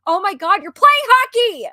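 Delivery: ordinary talk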